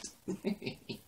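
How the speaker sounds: high, soft laugh; airy high-pitched chuckle; short wheezy giggle. Real, not acted. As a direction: giggle helplessly on little breath. Laughs at repeated melodramatic line.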